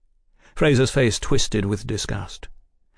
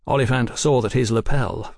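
usual speaking voice

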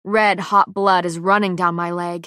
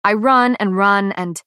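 plain reading voice

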